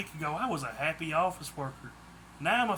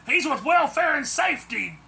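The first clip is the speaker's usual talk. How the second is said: higher, louder furious snap; snapped angrily; 9 semitones higher, 6 dB louder than usual. A narrator's reading. Daisy snaps at the kidnappers, angry and loud. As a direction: bite the words out at them. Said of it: "Daisy snapped angrily".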